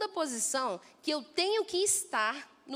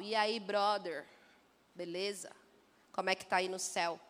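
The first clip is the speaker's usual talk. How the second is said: casual demais; 6 semitones lower than usual. Pretend. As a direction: greet God like a buddy, lazily. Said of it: "vibe conversacional, casual". (em tom casual debochado, arrastado e lento)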